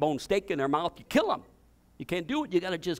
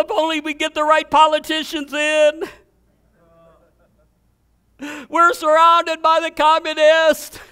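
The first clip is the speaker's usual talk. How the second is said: mock panic; 8 semitones higher, 8 dB louder than usual. Preacher mocks fearful Christians.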